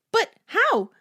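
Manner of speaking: high, loud disbelief; aghast, voice leaping up; shocked question. A narrator's reading. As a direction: blurt it out in shock. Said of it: "Corky started".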